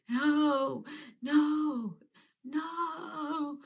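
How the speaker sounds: rising, pleading no, fading out; begging the train not to take her. Retold or imitated. Retelling the loss, a pleading no that fades. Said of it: "I just kept screaming".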